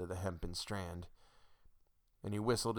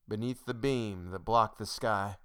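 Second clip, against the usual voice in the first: usual speaking voice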